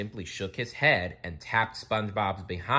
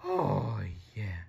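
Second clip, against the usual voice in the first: long sighed "oh", settling down; sighing with relief; relieved sigh; 4 dB quieter than usual. A narrator's reading. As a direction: sigh it out as the pain eases. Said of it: "he sighed with relief".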